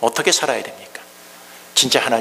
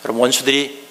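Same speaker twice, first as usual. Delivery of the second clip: usual speaking voice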